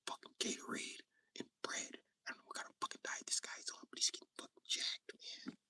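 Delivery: very soft unvoiced gossip; hushed gossip whisper.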